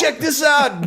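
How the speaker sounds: high and loud; excited showing off. Retold or imitated. Dad showing his kids his new look, excited and high. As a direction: show it off with a big excited lift. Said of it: "And you were like".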